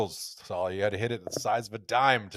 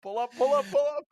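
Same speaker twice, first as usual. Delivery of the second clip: high, repeated mock alarm; mock cockpit warning. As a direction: lightly play an urgent abort warning. Mock alarm at failing accent.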